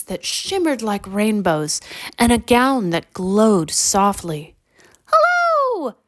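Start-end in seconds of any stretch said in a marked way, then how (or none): 5.1-5.9 very high, tiny squeaking voice; squeaky fairy. A narrator's reading. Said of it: "squeaked the tiny fairy".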